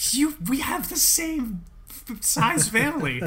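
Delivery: high, slow laughing objection; laughing protest. Real, not acted.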